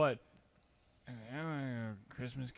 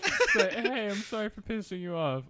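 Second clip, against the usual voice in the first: high laughing burst into a mock apology; mock sorry while cracking up; 10 semitones higher, 7 dB louder than usual. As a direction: giggle through the apology.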